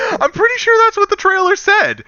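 high, loud, cracking up; laughing protest. Real, not acted. Defending what the trailer said, high laughing protest. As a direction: protest through laughter, voice shooting up.

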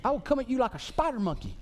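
cocky action-hero quote; movie impression. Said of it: "Who says".

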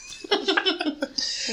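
high burst of laughter; real laugh. Real, not acted. Laughing after retold line.

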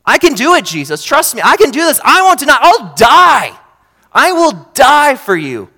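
overconfident, promising the world; Peter's boast. Retold or imitated. Peter boasts to Jesus that he can do this, eager and high.

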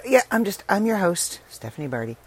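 ordinary talk

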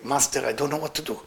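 soft, subdued plea, lightly tearful. A narrator's reading. Thief pleading at his master's grave, softly tearful. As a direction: begin a quiet lament to the master. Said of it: "He lie on the grave and start crying".

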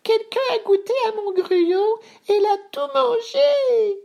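tiny, extremely high whine; complaining baby bear. A narrator's reading. Bébé Ours finding his porridge all eaten, high whine. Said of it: "se plaignit Bébé Ours".

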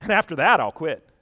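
mock vengeful vow. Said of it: "Samson said to them".

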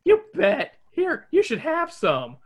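high, loud cheerful Shaggy. Acted. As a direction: offer it like eager Shaggy. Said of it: "Shaggy says".

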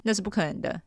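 ordinary delivery, nothing marked

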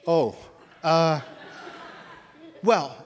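halting, loud stammer with long pauses; re-enacted stunned hesitation. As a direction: act lost for words, one word at a time. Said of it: "like, kind of stunned".

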